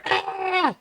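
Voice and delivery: very high yelp of glee; high squeal. Retold or imitated. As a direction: squeak it up high like a girl at the arcade. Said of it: "I was going".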